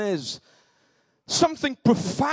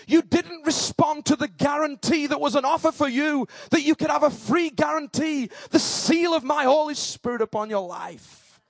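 raised, forceful pulpit voice; God's stern rebuke; 6 semitones higher than usual. Acted. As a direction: thunder it out as God passing judgement. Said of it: "he'll say".

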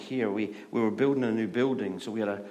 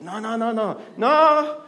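high, hammed-up protest; frantic pleading; 12 semitones higher, 10 dB louder than usual. Wife trying to stop riled husband, frantic. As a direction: imitate a wife frantically heading him off.

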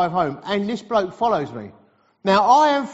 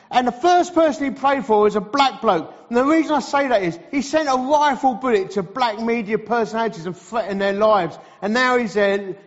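ordinary talk

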